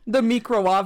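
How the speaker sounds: microwave said as mee-kro-wahv; The microwave with a mock foreign accent; meme pronunciation. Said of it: "I saw that meme today".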